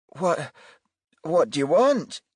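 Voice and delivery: put-on high, anxious older woman; worried, faltering woman; nervous mother. A narrator's reading.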